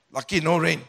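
ordinary talk, nothing marked

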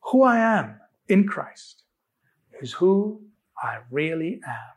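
measured call for everyone to repeat; leading an affirmation. Shown as a demonstration. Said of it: "Let's say it one more time".

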